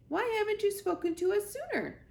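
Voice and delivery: very high, sharp owl screech. Acted. The owl screeching from high in the tree. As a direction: screech the question down. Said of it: "screeched the owl".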